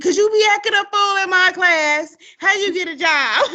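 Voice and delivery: sassy, loud and high-pitched teacher comeback; retold scolding.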